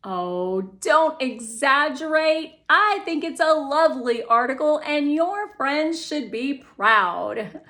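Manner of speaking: mom waving away her daughter's fears; brushing-off mom. Acted.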